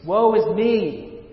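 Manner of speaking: slow, loud, high cry of woe; anguished lament. Acted. Isaiah crying out before God's throne, anguished wail. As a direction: draw out the woe like a man undone.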